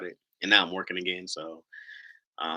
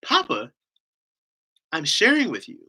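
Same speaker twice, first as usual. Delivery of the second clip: put-on higher, emphatic small-child reply; little daughter; 7 semitones higher, 7 dB louder than usual. Daughter tells papa she is sharing, emphatic little-girl voice. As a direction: imitate a little girl setting papa straight. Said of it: "She's like".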